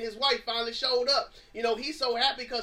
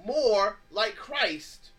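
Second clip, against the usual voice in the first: slow emphasis; much slower than usual. Acted. Preacher slows for emphasis.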